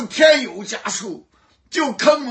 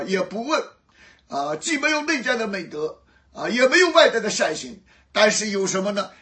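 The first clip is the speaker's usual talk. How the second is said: ordinary delivery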